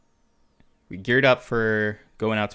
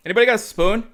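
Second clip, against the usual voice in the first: much higher and louder, smugly casual; smug call-out; 12 semitones higher, 8 dB louder, faster than usual. Retold or imitated. Soldier showing off to squad, loud smug call. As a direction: toss the question out loud and high, like you're showing off.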